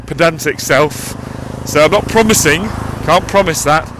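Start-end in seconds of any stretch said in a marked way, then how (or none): none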